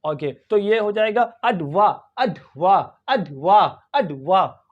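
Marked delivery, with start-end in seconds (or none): 1.2-4.6 अडवा as "ud-vuh", no R; short vowels in अडवा, ending without R; silent R. Shown as a demonstration. Teacher drills British English, R-less syllables. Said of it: "R is not pronounced in British English".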